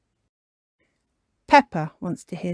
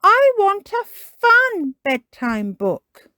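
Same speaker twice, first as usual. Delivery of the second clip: high, loud, slow demand; Peppa Pig; 8 dB louder, slower than usual. Acted. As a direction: stretch the demand like a pouting child. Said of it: "says Peppa".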